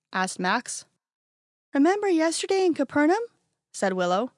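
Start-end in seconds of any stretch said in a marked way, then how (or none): 1.7-3.2 put-on lightly higher curious kid voice; a young kid asking, puzzled; child's voice. A narrator's reading. A kid among friends asking why, lightly childlike voice.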